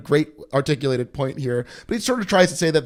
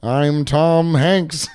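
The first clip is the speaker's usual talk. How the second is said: bleating like a ram; 3 dB louder, much slower than usual. Pretend. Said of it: "You're changing".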